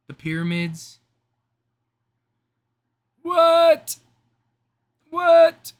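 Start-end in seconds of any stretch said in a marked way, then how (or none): 3.2-5.7 high, loud "what?!"; shocked shout. Pretend. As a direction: blurt it out in disbelief. Mock astonishment at pyramids.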